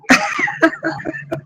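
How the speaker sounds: high gleeful squeal of laughter. Acted. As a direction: squeal with petty glee. Petty triumph laugh.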